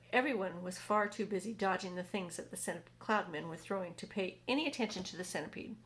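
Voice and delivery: plain reading voice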